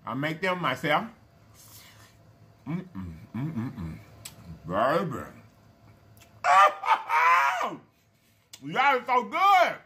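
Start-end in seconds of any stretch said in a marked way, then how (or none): 2.7-4.0 low delighted mm-mm; savoring hum. Real, not acted. Tasting meatball. 6.4-7.8 high, loud burst of laughter; shrieking laugh. Real, not acted. Delighted by food. 8.6-9.8 delighted. Real, not acted. After laughing at the food.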